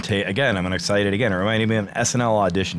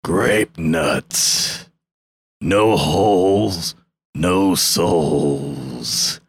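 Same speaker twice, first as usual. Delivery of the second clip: deep, very slow, ominous wrestler voice; Undertaker voice; 8 semitones lower, much slower than usual. Acted. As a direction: drop low and crawl through each word like a dark ringside entrance. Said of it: "I gotta get it in my Undertaker voice".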